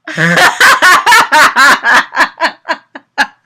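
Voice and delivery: long loud laughing fit; real laughter. Real, not acted. Reader cracking up at her own story, real laughter. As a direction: lose it laughing.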